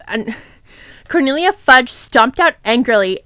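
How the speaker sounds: ordinary delivery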